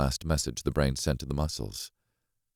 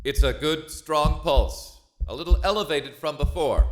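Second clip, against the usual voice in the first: higher, tense voice reporting the pulse; 13 semitones higher, 4 dB louder than usual. Acted. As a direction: speak up, nervous but steady. Narrator reports the pulse aloud.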